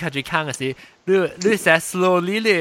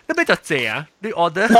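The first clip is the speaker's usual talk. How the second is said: ordinary delivery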